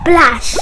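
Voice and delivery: loud, burst-out splash; splash sound effect. Acted. Hare falls in the waterhole, loud splash noise. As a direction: make the water crash with the word.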